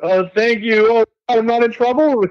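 high-pitched, gushing relief; high, relieved disbelief; surprised relief. Retold or imitated. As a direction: re-enact astonished gratitude. Retelling his surprised relief.

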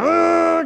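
high, loud mock growl of exasperation; frustrated noise. Preacher imitating what goes on in your head, frustrated noise. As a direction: burst out with a high inner scream. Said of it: "you've all this kind of".

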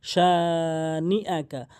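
সা drawn out one count, then short নি আকা; recited সা নি আকা with a long first vowel; one-alif madd. Shown as a demonstration. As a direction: stretch সা, keep নি আকা unlengthened. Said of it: "এক আলিফ টানবেন".

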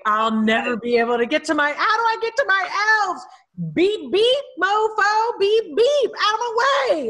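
high, frantic mock-desperate cry; frantic panic. Elf wrangler trying to get to the elves, frantic high-pitched cry. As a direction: plead and honk through a crowd, voice climbing high. Acting out pushing through crowd.

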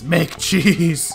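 giggling playful order to a cow; laughing command. Real, not acted.

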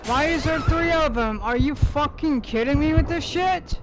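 furious gamer shouting at the boss; raging yell. Real, not acted. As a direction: yell at the game in real rage.

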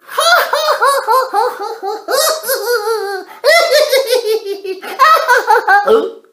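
high, loud drawn-out ho-ho laugh; long gleeful cackle. Acted. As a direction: cackle on and on at someone's trouble. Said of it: "Alfie likes to laugh".

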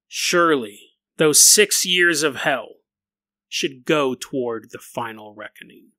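slow, grave reading; dramatic letter reading. Acted. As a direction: read it like a doomed man's letter.

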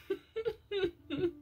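giggle. Real, not acted. Reader still amused by the knockout story, soft high giggle. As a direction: let a high giggle leak out softly.

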